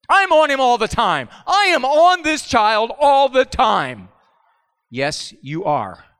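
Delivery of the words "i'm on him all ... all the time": exasperated parent complaint. Retold or imitated. Imitating frustrated parent.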